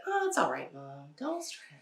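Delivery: put-on airy unbothered child; lightly voiced relaxed child; calm child voice. Phlegmatic child voiced calmly.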